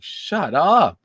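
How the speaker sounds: high, loud disbelieving snap; exasperated retort. Retold or imitated. Host reacts to the crying offender with a sharp retort. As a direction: shut him down in mock outrage. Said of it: "and it's like".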